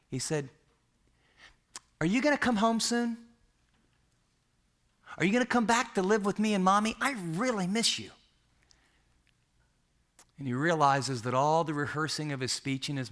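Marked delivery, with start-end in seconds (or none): 2.0-8.1 put-on high, small child's voice begging her daddy to come home; a young daughter asking, near tears; little girl pleading. Daughter pleads with departing father.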